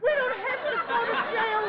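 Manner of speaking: high giddy squeal; overjoyed. Acted. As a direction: squeal it with relief. Repeated in joy.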